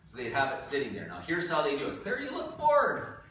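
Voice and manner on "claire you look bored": teasing. Real, not acted. Teasing a bored student.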